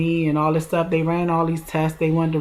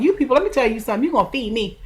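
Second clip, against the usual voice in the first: hungry demand; 7 semitones higher, faster than usual. Retold or imitated. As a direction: rattle it off like a fed-up patient laying down the law. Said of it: "I was like".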